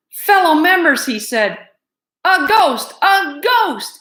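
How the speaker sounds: high, loud alarm; terrified cry. Acted. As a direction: point and cry out in fright. Head mouse terrified, pointing.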